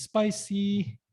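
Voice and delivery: ordinary talk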